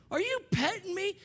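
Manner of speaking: very high-pitched incredulous question; mock astonishment. Preacher to little girl stroking his face, mock astonished. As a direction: squeak it out in playful disbelief.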